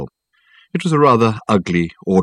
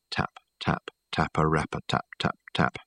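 breathy whispered tapping; whispered taps; 4 semitones lower, 11 dB quieter than usual. A narrator's reading. Narrator whispers the tapping on the window. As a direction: whisper the taps softly, like fingers on glass.

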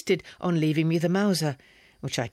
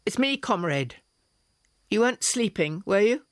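higher, a slight crack at the end; voice giving way; 7 semitones higher, 4 dB louder than usual. A narrator's reading. Nervous Slavkin at her bedside, voice giving way slightly. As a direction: let the voice slip up and waver. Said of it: "His voice gave way a little".

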